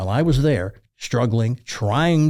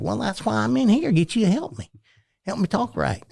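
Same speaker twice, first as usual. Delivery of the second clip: put-on hillbilly accent; 9 semitones higher than usual. Student asking teacher to help him talk right, hillbilly accent. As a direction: imitate your young hillbilly self asking for help. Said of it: "you talk like this".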